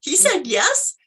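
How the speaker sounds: high-pitched, disbelieving reaction; astonished. Retold or imitated. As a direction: replay the amazement, voice leaping up. Reacts to dad's unexpected yes.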